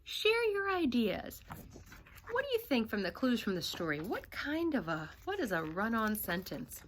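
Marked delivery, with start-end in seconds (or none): none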